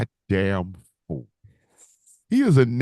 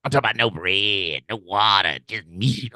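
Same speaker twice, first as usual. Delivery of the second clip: lightly imitated commentator overselling; mock announcer hype, lower pitched; Booker T; 6 semitones lower than usual. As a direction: punch each item like a sales pitch. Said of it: "he was selling, selling it".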